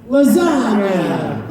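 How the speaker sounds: drawn out "Lasagna" with the gn as ny; Italian pronunciation. Shown as a demonstration. Host teaching fans Italian, slow Italian-style pronunciation. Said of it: "Spell it out in your mind".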